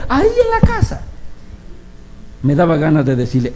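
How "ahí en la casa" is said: high, put-on voice of the mother; matter-of-fact mother. Mother telling the preacher her husband lives at home, high. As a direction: answer it as if obvious.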